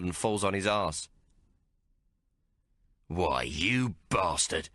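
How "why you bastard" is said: angry. Acted. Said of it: "he says, angry now".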